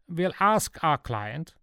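put-on slow, high Swiss German accent; measured, high-pitched Swiss German dealer; Swiss German Jacko. A narrator's reading. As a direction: negotiate coolly in Swiss-German-accented English.

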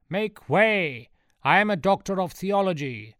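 high, loud self-important shout; commanding call. A narrator's reading. Priest pushing through the crowd, calling out grandly. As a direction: call out to clear a path.

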